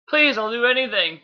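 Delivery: high-pitched desperate plea; crying out. A narrator's reading. Bee begging Megatron for Optimus's life, crying out high. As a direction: plead with the voice pushed way up. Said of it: "B cried".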